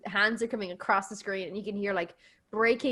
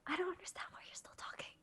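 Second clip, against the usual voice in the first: exasperated whisper, much softer; annoyed whisper; 8 semitones higher, 19 dB quieter than usual. Retold or imitated. Liam scolding Todd for talking during the hunt, whispered. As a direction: whisper it in disbelief at the chatter. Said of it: "He was like".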